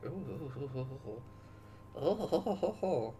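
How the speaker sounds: ooh and ho-ho in anticipation; impressed oohing. Real, not acted. Streamer relishing such a good game, impressed oohing.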